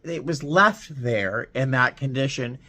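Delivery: usual speaking voice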